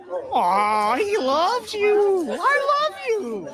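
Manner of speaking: high, very slow syrupy coo; mock adoring liberal. Vlogger mocks residents embracing migrants, slow high coo. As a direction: stretch it out in falsetto.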